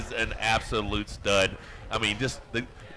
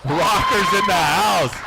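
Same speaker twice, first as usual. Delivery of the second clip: louder and slower, laughing through it; excited laughing cheer; 5 semitones higher, 8 dB louder, much slower than usual. Real, not acted. Co-host cheering the winner to the crowd, laughing and loud. Hyping winner after announcement.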